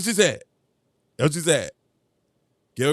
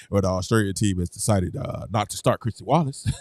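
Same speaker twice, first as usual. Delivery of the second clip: mock announcer; 11 semitones lower, 4 dB quieter than usual. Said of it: "broadcast quote again".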